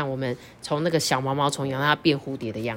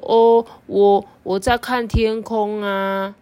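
very slow, dreamy voice; slow dreamy caterpillar; 4 semitones higher, 5 dB louder, much slower than usual. Acted. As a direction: drawl it like a daydreaming bug. Xiaoqiu answers dreamily.

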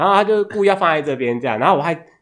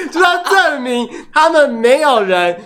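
laughing; 6 semitones higher, slower than usual. Real, not acted. Cracking up at absurd story.

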